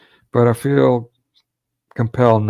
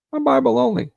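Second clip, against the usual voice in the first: high, smug mock voice; high mocking sing-song; mocking Bible-only boast. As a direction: mimic a smug believer sneeringly. Mocking self-proclaimed Bible-only believers.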